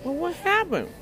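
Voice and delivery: high, prying question voice; nosy questioner voice. Retold or imitated. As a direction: play the nosy asker. Imitating the questioner again.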